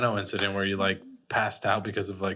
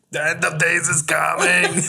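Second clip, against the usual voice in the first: mock doomsday prophet; 6 semitones higher, 6 dB louder than usual.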